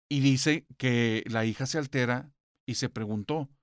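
ordinary talk

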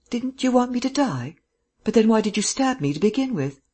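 high, incredulous questioning; bewildered. A narrator's reading. Man asks his attacker why she saved him, bewildered. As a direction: press for an answer in high-pitched disbelief.

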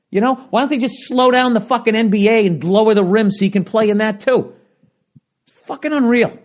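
high-pitched, indignant tirade; worked-up, fed-up ranting; exasperated comic rant. Acted. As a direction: rail at the complainers with pitch kept high. Comic rant against the complainers.

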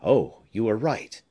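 ordinary delivery, nothing marked

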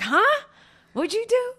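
shrill, high jealous huh, suddenly suspicious. Retold or imitated. Wife imitates her own jealous reaction, high and suspicious. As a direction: act the jealous girl catching him out. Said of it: "I'm like".

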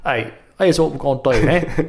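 hammed-up British schoolboy; Harry Potter British accent. Showing off his movie British voice, mock British. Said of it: "it's Harry Potter".

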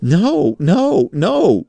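very high, loud wailing cry; terrified shriek. A narrator's reading. Suspect cowering on his knees before his accusers, shrieking. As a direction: shriek in desperate denial. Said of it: "shrieked Raikes".

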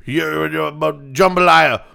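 mock mumbled play call; unintelligible coach. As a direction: garble up to one loud, slow word. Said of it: "All you're gonna hear is".

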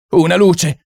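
frantic high shout; shrieking for light. Acted. Someone in the dark room shrieks for a light, frantic. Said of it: "strillò qualcuno".

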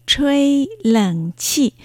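吹冷气 drawn out slowly, syllable by syllable; model pronunciation. Shown as a demonstration. Teacher introducing today's first word to learners, slow model pronunciation. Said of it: "改めて今日の最初の言葉をご紹介".

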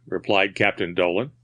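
usual speaking voice

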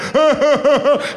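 high loud cackling laugh; granny's cackle. Retold or imitated. Grandma bursts into a high cackling laugh. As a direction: cackle like a mad old lady. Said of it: "Pero por qué te ríes".

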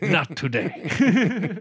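slow, laughing through it; laughing drawn out. Real, not acted. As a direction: stretch it out while laughing.

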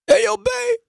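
a badly faked, high-pitched celebrity impersonation. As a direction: call out to your partner in a silly high voice. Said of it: "that's my [name]".

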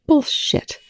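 a sharp, high snap of disbelief; snapped. A narrator's reading. Volker calls out Rush's claim with a high snap. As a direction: bite the word off at him. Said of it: "Volker snapped".

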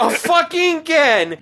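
very high, loud exclamation of disbelief; comically outraged heckle; incredulous outburst. Acted. Outraged repeat at the delay.

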